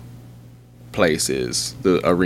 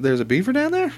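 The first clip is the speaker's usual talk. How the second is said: retold surprise. Shocked to see beaver.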